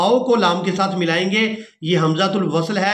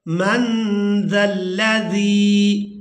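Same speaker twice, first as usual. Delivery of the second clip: slow, melodic tilawat, drawn out; Quran recitation; 2 semitones higher, much slower than usual. Shown as a demonstration. Teacher reciting the verse to students, slow sung tilawat. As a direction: chant each word long and sung.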